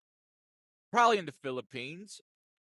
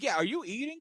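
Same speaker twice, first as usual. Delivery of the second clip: incredulous; 8 semitones higher than usual. Retold or imitated. Repeated accusing question.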